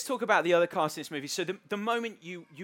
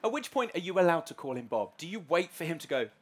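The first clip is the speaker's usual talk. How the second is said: ordinary talk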